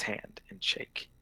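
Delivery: whispered aside. Real, not acted. Player narrating his handshake in a whisper. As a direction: breathe it softly.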